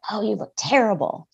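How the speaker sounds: mock the nasty voice in her head; a hint of a sneering inner critic; harsh inner critic. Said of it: "she said".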